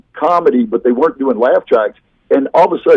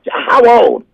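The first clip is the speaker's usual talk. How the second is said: put-on high-pitched insistent old man; elderly man; 11 semitones higher than usual. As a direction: press for an answer as an old man. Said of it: "eighty, late eighties".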